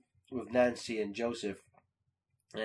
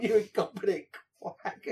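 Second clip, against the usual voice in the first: amused requote; 6 semitones higher than usual. Retold or imitated. Fan repeats Dale's line to friend, amused requote. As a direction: replay the outburst at half force.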